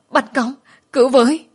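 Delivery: high, loud shout for help; frantic yelling, rising; screaming. A narrator's reading. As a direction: scream it out while clinging on. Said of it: "không ngừng la hét".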